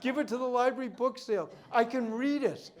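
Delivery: high, bright burst of happy relief; delighted relief. Retold or imitated. Client telling the appraiser, retold with high excited relief. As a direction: voice a thrilled client freed of a worry. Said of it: "I'm really happy".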